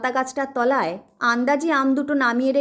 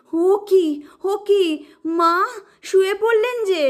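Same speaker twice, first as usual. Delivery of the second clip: alarmed cry; 6 semitones higher than usual. Acted. Alarm as mother collapses.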